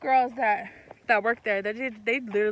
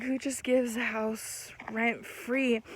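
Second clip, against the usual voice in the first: inner voice; 6 dB quieter, slower than usual. Retold or imitated. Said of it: "in the back of my head".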